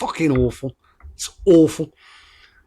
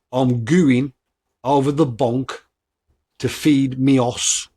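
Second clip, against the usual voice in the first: slow repeat; much slower than usual. Shown as a demonstration. Podcaster repeating a saying for listeners, slowly. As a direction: say it slowly, pausing between the parts. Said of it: "I'll say it again slowly".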